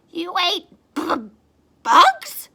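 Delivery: halting, then a very high loud squeak; stuttering shock. Acted. A bat stammers at Stellaluna's story, shocked. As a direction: stammer in disbelief. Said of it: "stuttered one".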